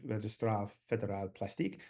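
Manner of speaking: lightly French-accented pronunciation. Shown as a demonstration. Speaker improvising the registry's French name, French accent. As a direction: say it as improvised French, low-key. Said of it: "say the English word with a French accent".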